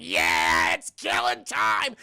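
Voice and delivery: shouted, drawn-out mock war cry; savage battle cry. Logan about to stab a frozen enemy, savage shouted battle cry. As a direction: roar it out slowly at full volume.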